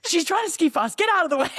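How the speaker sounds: high, urgent mock shouting; imitated loud calling. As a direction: holler to clear the track, imitating a teammate. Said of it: "it was pretty loud".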